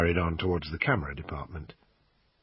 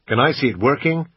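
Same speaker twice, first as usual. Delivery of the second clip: put-on a louder American accent, kept up while haggling; bad American accent; 5 semitones higher, 5 dB louder than usual. Brit quizzing the shop assistant, bad American accent. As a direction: keep the clumsy American put-on going. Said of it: "in my usual bad American accent".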